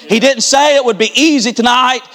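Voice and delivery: preacher building to the final altar call; building preaching. Acted. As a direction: climb steadily toward a shout. Closing climb of sermon.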